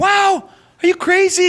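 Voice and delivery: very high, slow, stretched wow; cartoonish shocked squeal; mock disbelief. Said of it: "And I said".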